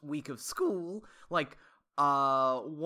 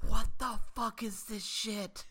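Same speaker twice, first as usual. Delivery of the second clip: mouthed disbelief; 6 semitones higher, slower than usual. Retold or imitated.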